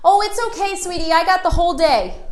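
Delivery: cheerfully stubborn, settling in; pushy old salesman. Retold or imitated. Old salesman refuses to leave.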